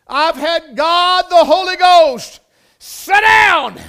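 slow, building to a loud high shout; preaching shout. Real, not acted. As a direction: cry it out at the peak of the sermon. Preaching climax on God's presence.

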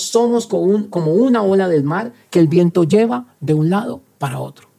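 normal talking voice

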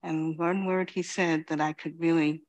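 usual speaking voice

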